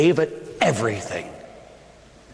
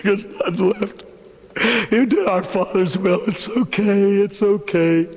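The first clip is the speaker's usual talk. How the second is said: grieving tender hush; 3 semitones higher than usual. Acted. Mary grieving over body.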